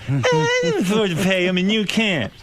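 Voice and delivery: imitating Jay. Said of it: "He goes".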